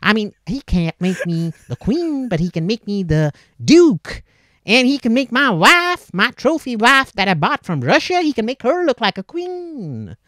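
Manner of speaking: hammed-up awestruck American with swooping pitch; gullible American yuppie. New-money American marvelling at an English visitor, mock American yuppie voice. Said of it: "a yuppie little American who's never known money".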